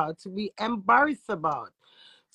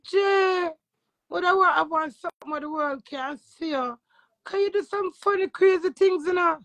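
a lightly voiced Tommy, higher pitched; friend's voice, pitched up but otherwise close to own; quoting Tommy; 7 semitones higher than usual. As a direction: mimic a friend pitching an idea. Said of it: "And Tommy say".

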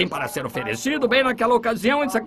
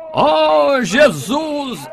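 higher, louder call of Jesus' name; loud cry; 5 semitones higher, 4 dB louder, much slower than usual. Retold or imitated. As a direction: call out over the crowd. Blind man crying out to Jesus.

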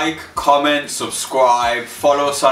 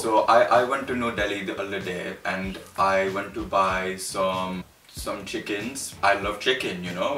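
lower-pitched put-on Indian accent; 3 semitones lower, 5 dB quieter than usual. Recounting a New Delhi shopping trip, put-on Indian accent. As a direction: tell it in a mock Indian accent. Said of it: "last accent I'm going to do is an Indian".

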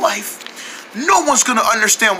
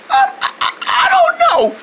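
lost voice. Acted. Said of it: "I lost my voice".

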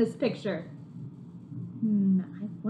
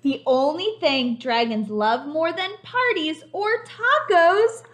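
high, loud build to the last words; thrilled storybook climax; excited finale; 9 semitones higher, 8 dB louder, slower than usual. A narrator's reading. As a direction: land the big ending for the kids. Book's big finale line.

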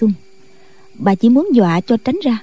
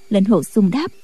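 usual speaking voice

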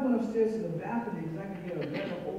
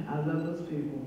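ordinary delivery

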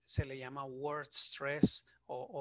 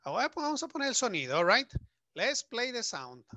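higher, livelier lead-in to the audio; excited announcement; 9 semitones higher, 7 dB louder than usual. Real, not acted. Teacher rallying students before playing the audio, excited. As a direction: hype the class up for the recording. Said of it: "teacher builds up to audio".

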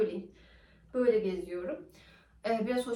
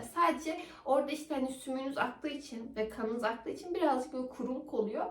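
ordinary talk

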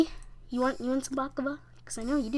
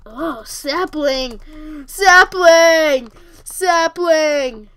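building to a very loud, higher yell; loud drawn-out hollering; mock shouting; 4 semitones higher, 14 dB louder, much slower than usual. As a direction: bellow the word, drawing it out. Calling out sapling, then apologizes.

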